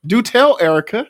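high, loud eager "do tell"; bursting with eager interest; excited. Real, not acted. Excited reply to caller.

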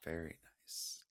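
hushed whisper. Real, not acted. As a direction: breathe the words softly. Whispered reply after breathing.

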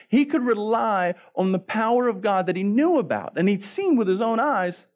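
high, insistent build through a repeated refrain; impassioned preaching. Acted. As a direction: preach it in waves, each clause pitched high, each refrain dropping back. Preaches a rising refrain as Elijah.

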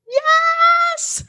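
high, drawn-out cheer; thrilled yes over the Cabo trip; excited yes. Real, not acted. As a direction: squeal it out with joy.

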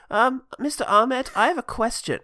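imitating a timid pupil; schoolkid. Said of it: "One of him's like".

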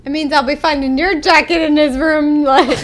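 loud teasing through laughter; laughing. Real, not acted. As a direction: crack up while accusing.

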